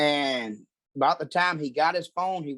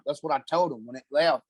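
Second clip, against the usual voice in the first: ordinary delivery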